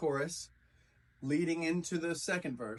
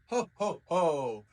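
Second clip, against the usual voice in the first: a hammed-up ho ho ho belly laugh; mock a hearty Santa laugh; jolly laugh; 5 dB louder, slower than usual. Shown as a demonstration. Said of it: "like we're having a jolly laugh".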